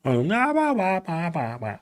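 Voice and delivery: mock Thai babble, pitch sliding downward; caricatured Thai-sounding gibberish; mock Thai accent. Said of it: "that language, it's got a wah, wah, wah in there".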